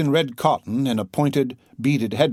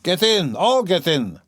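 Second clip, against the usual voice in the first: put-on drunken cry; 5 semitones higher than usual. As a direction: roar it, waving them all in. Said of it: "cried Mikolka".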